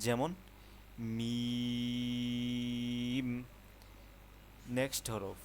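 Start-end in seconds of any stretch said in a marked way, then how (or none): 1.2-3.3 মিম drawn out with both lips pressed together; mim with closed lips. Shown as a demonstration. Teacher shows মিম from both lips, slowly held. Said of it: "দুইটি ঠোঁট একসাথে হয়ে উচ্চারণটা আসবে".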